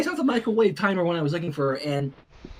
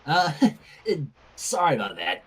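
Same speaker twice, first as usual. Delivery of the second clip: sheepish apology; 5 semitones lower than usual. Acted. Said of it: "rubbed the back of her neck".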